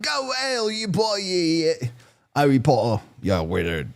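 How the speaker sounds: high-to-normal, hammed-up British insult; playful try at a British accent; mock British accent. Radio host imitates the ranting woman in a mock British accent. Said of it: "do it in a British accent".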